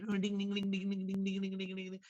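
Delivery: rhythmic playful ding ding to mimic a wagging tail; light sing-song ding ding; dog tail wagging sound. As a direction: chant it like a tail swishing. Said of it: "Have you seen dog wagging tail?".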